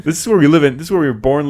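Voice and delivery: high-pitched, hammed-up protest; mock panicked plea. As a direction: plead as if trapped here for life. Acting out panicked Japanese locals.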